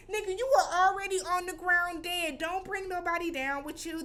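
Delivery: high, loud-starting telling-off; worked-up, high-pitched reproach; indignant scolding. Retold or imitated. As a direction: chew him out in exasperation. Scolding the man grabbing his leg.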